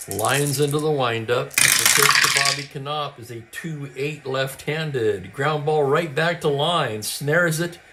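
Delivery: brisk radio ballgame call, lightly lifted; live play-by-play. Acted. Host calling a tabletop baseball game, play-by-play voice. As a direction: call it like a classic radio announcer.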